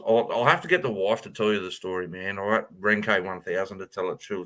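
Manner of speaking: normal talking voice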